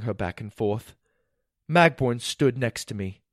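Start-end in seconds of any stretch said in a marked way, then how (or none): none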